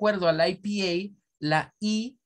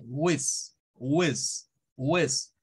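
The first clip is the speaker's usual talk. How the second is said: "Whiz" as the strong, accented syllable; stressed syllable; 3 semitones lower, much slower than usual. Shown as a demonstration. Teacher shows where the accent falls, firmly stressed. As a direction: put full weight on each separate "Whiz". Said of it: "donde debemos de hablar más fuerte".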